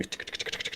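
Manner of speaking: quiet unvoiced tongue clicks; soft, rapid clicking rattle; rattling frills. You can hear the tick.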